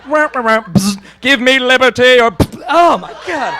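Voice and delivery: hammed-up dimension-jump noises; portal sound effects. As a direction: make sci-fi portal zaps with yelps.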